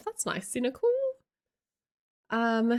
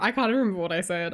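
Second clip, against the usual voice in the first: ordinary talk, nothing marked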